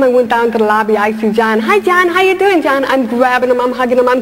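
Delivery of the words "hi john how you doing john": high-pitched, delighted hello; gushing greeting. Acted. Hotel guest spots her favourite bellhop in the lobby, gushing greeting. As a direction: greet him like a dear friend, pitched high.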